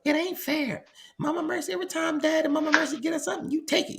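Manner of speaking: put-on high, fast, soft kid's complaint; a little boy protesting to his mother; child voice. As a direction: whine it like a nine-year-old. Son voiced complaining in story.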